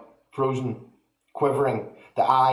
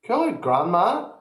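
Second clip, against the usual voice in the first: put-on higher boyish reassurance; calm boy; 8 semitones higher than usual. A narrator's reading. George reassuring grandma, higher. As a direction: brush it off like a cheeky lad. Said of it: "George said".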